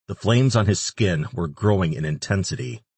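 usual speaking voice

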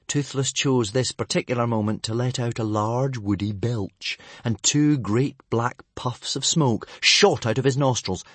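plain reading voice

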